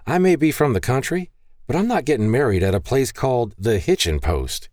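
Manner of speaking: lightly voiced young woman waving off a silly idea; Maggie's voice. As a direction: toss it back with a mild snort of disbelief. Maggie voiced, snorting refusal.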